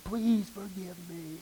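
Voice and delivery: slow, soft retold plea through tears; a big man weeping as he begs the pastor. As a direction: beg forgiveness slowly and quietly, half-acted. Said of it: "he was weeping and crying".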